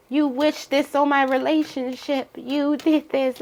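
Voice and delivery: high accusing mock voice, slowing down; mock accusation. Upset person accusing the Libra in a high whine. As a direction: blame them in a high put-on whine. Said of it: "that person is like".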